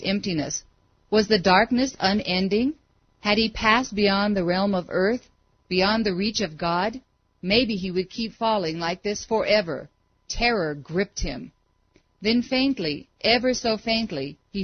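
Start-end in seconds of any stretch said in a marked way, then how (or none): none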